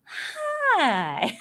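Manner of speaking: high, stretched Southern hi; grandson's Southern accent. Retold or imitated. Grandmother imitating grandson's hi, high Southern drawl. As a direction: squeal out a child's Southern hi. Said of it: "But his highs are just".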